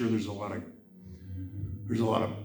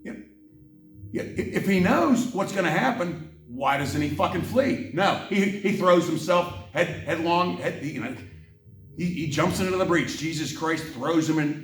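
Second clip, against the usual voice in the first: higher, heated rant; worked-up exasperation; angry rant; 4 semitones higher than usual. Real, not acted. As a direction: fume at the story, pressing hard. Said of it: "I get very angry sometimes".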